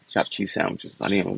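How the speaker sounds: ordinary talk, nothing marked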